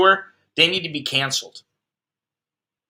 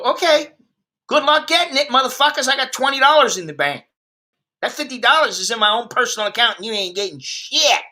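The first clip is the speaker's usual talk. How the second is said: much higher, cocky brush-off; mock defiant; 7 semitones higher than usual. Broke defendant taunting the court, higher mock defiance. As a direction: taunt the court like you've got nothing to lose. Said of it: "you just go".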